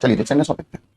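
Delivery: ordinary talk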